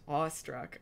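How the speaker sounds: ordinary delivery, nothing marked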